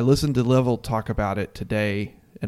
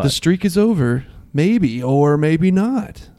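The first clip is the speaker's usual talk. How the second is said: higher, drawn-out TV teaser; mock sports-show tease; 6 semitones higher, 4 dB louder, slower than usual. Co-host parodies shows on the Kansas streak, mock teasing. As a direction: stretch out the ending as a mock cliffhanger. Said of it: "on all the shows where you see".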